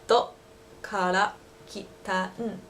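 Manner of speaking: slow, drawn-out Dokara kitan. Shown as a demonstration. Local repeating the question slowly, drawn out. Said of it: "say it a little bit slower for you".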